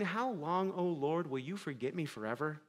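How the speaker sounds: soft, falling plea to God; hushed lament. Retold or imitated. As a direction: murmur the psalm like a lament. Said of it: "cried out like David".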